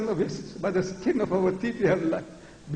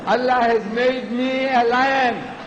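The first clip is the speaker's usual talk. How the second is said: slow, raised, drawn-out declaration; roaring proclamation; 4 semitones higher, 4 dB louder, slower than usual. Real, not acted. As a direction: proclaim it like a lion. Contrasts himself with chicken voices.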